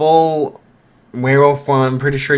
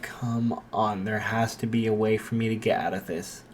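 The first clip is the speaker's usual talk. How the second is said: dreading mutter; 3 semitones lower, 9 dB quieter than usual. Acted.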